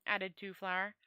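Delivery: plain reading voice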